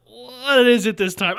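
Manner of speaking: drawn-out, high, loud exasperated question; mock dread. Opening a friend's news text, hammed-up dread. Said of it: "scaring me with new".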